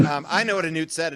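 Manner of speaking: normal talking voice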